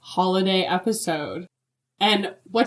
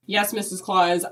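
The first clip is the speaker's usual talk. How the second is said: ordinary delivery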